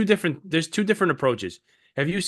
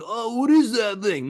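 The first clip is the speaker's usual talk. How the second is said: higher, put-on wondering voice; mock curiosity; 6 semitones higher than usual. Mocking people creeping around looking, mock curious. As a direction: play the gawker who creeps closer.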